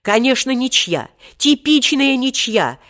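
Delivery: high, hasty placating; conciliatory exclamation. A narrator's reading. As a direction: agree emphatically to smooth things over. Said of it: "примирительно воскликнул".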